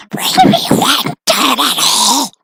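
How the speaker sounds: slow creaky creature voice; mild Gollum rasp. Acted. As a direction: wheedle it like Gollum. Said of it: "Are you Gollum?".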